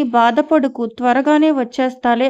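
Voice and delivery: usual speaking voice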